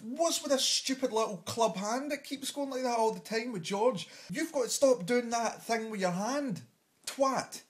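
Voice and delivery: high-pitched exasperated scolding; irritated rant. Acted. Comic scolding George about his hand, irritated. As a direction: tell them off, fed up. Ranting at presenter's hand gesture.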